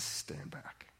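hushed, breathy "stand back"; a tense whispered order; whispered warning. Retold or imitated. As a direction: drop to a whisper to hold them off. Prophet warning the crowd back.

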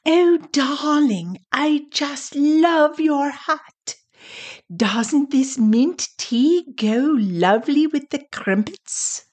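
high, slow, posh British English tea-party voice; hammed-up upper-class British accent, drawn out and lilting; mock British accent. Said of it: "practicing our British accent".

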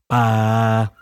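same note played on guitar. Shown as a demonstration. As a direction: let the guitar answer. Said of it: "Which one was the guitar?".

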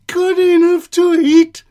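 high, loud alarmed shriek. A narrator's reading. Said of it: "shrieked the gingerbread man".

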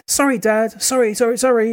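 put-on be the toddler who just hurt his dad; upset little boy. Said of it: "Ethan cried".

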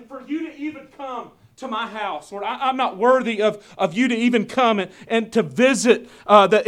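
loud, driving sermon delivery; booming pulpit voice; full-force preaching. Acted. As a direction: preach it out to the congregation.

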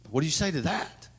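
ordinary talk, nothing marked

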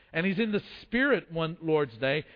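ordinary delivery

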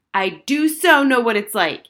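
loud, high, indignant yell; screaming. A narrator's reading. Girl yelling back at her mother, indignant.

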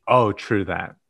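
ordinary talk, nothing marked

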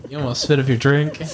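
usual speaking voice

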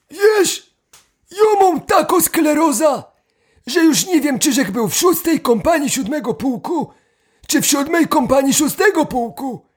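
put-on high, shaky old man. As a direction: speak like a forgetful old grandpa. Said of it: "dwóch dziadków".

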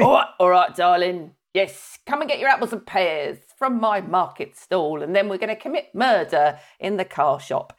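a hint of a hammed-up Cockney; mock Cockney. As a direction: play up an EastEnders Cockney. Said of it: "people watching EastEnders".

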